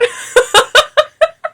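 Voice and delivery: a high laugh of disbelief; high disbelieving laugh. Real, not acted. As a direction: laugh high, unable to believe it.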